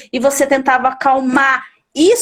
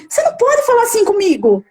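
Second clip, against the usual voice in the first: mimicking a defiant student; 7 semitones higher, faster than usual. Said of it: "Ele falava assim".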